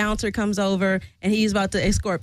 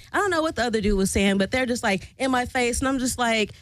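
normal talking voice

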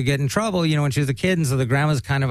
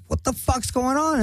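high, mock-alarmed wife; hint of a startled wife; 11 semitones higher than usual. Wife bursts out at the arrest, voiced high and alarmed.

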